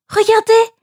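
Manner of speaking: high, eager call of a princess; pointing it out. A narrator's reading. Princess spotting the basket, calling out eagerly. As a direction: draw everyone's eye to the basket. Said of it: "la princesse montra la corbeille du doigt".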